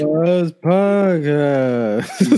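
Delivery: very slow, breathy sexy drawl; mock seductive. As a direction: parody a sultry podcast intro. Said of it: "very phone sex".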